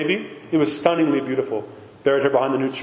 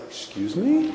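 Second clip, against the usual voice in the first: indignant excuse me; 7 semitones higher, 3 dB quieter, slower than usual. Retold or imitated. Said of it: "I was just like".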